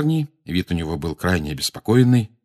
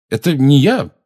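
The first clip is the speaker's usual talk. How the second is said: loud, high, ill-timed denial; blurted out; 7 semitones higher, 7 dB louder than usual. A narrator's reading. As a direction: blurt it out defensively. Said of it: "невпопад ляпнул Гарри". (ляпнуть невпопад)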